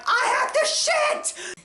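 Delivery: stretched-out high squeal of urgency; bolting for the bathroom, a drawn-out high panicked cry; desperate high cry. Retold or imitated. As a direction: yelp it while already running. Running to bathroom.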